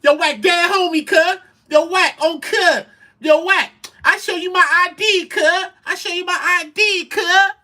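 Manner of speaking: high, loud mimicking of his plea; mocking Loose's voice. Retelling the Clubhouse argument, loud high mimicry. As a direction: squeal it like a man swearing on cuz. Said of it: "He said".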